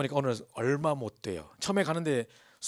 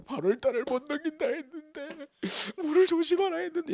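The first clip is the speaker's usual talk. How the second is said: wailing lament; 4 dB louder than usual. Retold or imitated. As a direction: cry out in grief at the fulfilled warning. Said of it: "이러고 있는 거예요".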